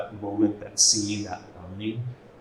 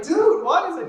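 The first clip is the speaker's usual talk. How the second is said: playful question; 5 dB louder than usual. Retold or imitated. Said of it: "I'm like".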